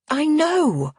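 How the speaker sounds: jumps high in shock. A narrator's reading. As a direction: caught off guard by his force. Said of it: "shocked by his vehemence".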